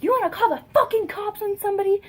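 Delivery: high, loud, fast imitation of an enraged mother; furious mom. Mom grabbing daughter's hair over the cops, imitated furious yell. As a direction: yell it as a retold parental threat. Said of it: "pissed, like, beyond fucking pissed".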